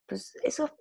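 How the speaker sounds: whispered aside. Real, not acted. Telling the host, a whispered aside. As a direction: drop to a whisper.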